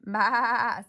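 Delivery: sheep baa. Acted. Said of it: "said Sheep".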